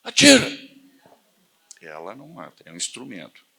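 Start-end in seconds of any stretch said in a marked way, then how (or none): 0.0-0.5 high, loud urging shout; excited shout. Retold or imitated. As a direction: yell it like the thrilled instructor. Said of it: "colocou a arma na mesa assim e falou".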